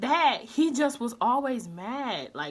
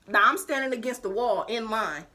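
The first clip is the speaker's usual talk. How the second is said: ordinary delivery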